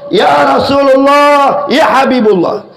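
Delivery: calling out. Acted. Dreaming servant calls the Prophet.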